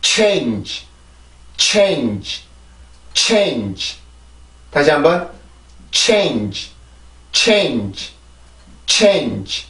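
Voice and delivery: "Change" in one falling syllable, strong airy ch. Shown as a demonstration. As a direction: push air on the ch of "change", let it fall away. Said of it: "발음을 하고 설명을 드릴게요". ("change"를 첫소리에 공기를 실어 한 음절로 발음)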